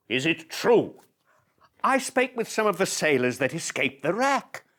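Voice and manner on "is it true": louder, higher Is it true; excited demand. Acted. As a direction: pounce on the answer. Eager demand.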